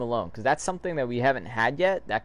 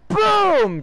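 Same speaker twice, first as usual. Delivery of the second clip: high, loud boom; big high-pitched explosion call; explosive boom; 9 dB louder than usual. Acted. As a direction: shout it like a blast going off. Mimics the explosion in comic.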